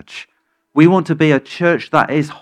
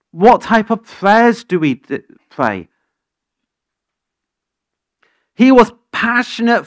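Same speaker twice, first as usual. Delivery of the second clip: louder, fervent sermon, rising and pressing; preaching at full force; 5 semitones higher, 4 dB louder than usual. Acted. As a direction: preach it to stir the church.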